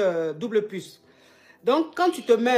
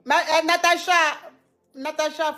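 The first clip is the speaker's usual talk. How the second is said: loud call of her name, pitched up high; 7 semitones higher, 5 dB louder than usual. Real, not acted. Coach calls out to Natacha to stop her, loud call. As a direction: shout her name across the room.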